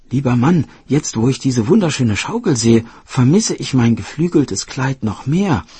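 coaxing fairy wife. Acted. Fairy wife begging her husband to try the dress, coaxing. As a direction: coax him with a lifted voice.

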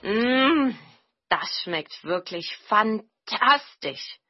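loud, high "mm", then slow praise; delighted exclamation. Acted. Tokebi tastes the jelly his hosts cooked, delighted exclamation. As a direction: savour it, drawing the words out. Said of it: "rief der Tokebi".

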